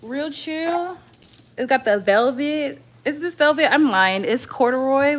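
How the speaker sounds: ordinary delivery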